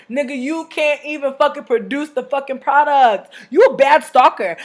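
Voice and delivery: slow loud scornful roast of a stalker. Retold or imitated. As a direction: read him for filth, loud and slow.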